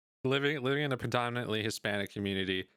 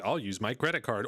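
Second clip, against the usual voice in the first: ordinary talk, nothing marked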